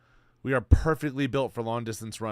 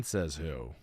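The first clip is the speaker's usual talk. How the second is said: low, soft mock-dumb voice; mocking echo; 8 semitones lower, 7 dB quieter than usual. Mimicking his friend's challenge in a low mock voice. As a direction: echo it back low like a dopey skeptic.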